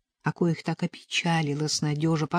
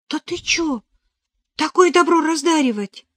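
high, alarmed protest; scandalized young novice, pitch jumping up; startled refusal; 11 semitones higher, 6 dB louder than usual. A narrator's reading. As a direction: recoil from the gift in a raised voice. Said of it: "начала испуганно отмахиваться".

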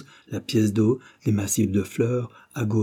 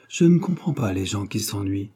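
plain reading voice

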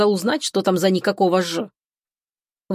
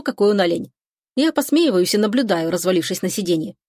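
plain reading voice

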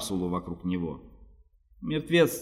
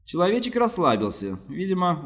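plain reading voice

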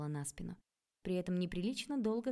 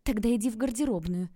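loud, raised urgent command; forceful and hurried, pitched up; urgent order; 4 semitones higher, 8 dB louder than usual. A narrator's reading. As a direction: push them to hide at once. Urgent order to hide from father.

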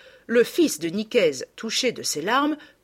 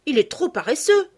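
exclaiming; 7 semitones higher, 5 dB louder than usual. Acted. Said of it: "s'écria Madeleine".

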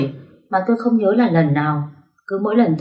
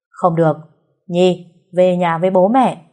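a father calling his daughter firmly, a bit louder; 4 dB louder than usual. Acted. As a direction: insist she come home, voice raised a little. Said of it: "Bố tôi gọi lớn hơn".

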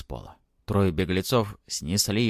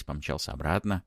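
usual speaking voice

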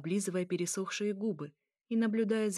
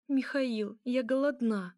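higher-pitched, pleading complaint; plaintive hunger; 5 semitones higher, 4 dB louder, slower than usual. Acted. Hungry dark princess appealing to Mikhail, plaintive.

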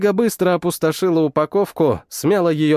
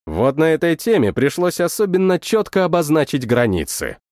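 plain reading voice

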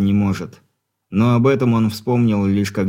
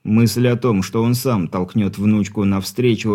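ordinary delivery

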